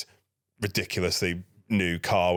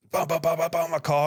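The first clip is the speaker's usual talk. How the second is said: imitated banging; 9 semitones higher than usual. Lads banging on his car.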